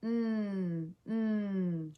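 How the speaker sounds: "Mm" as a long, soft N sound, "nnn"; letter-sound drill. Shown as a demonstration. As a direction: hold each "Mm" as N, tongue behind the teeth. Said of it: "Nigel Night Owl says".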